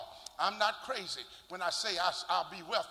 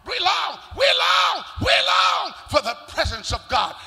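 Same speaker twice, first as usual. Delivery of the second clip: shouted, much higher, building; climactic cry; 9 semitones higher, 13 dB louder than usual. Real, not acted. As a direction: cry it out to the congregation.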